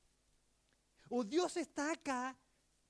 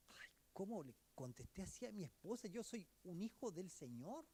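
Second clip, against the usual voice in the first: hushed, regretful words to oneself; whispered self-reproach; 3 semitones lower, 12 dB quieter than usual. Acted. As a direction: whisper it like a guilty thought. (susurro de arrepentimiento)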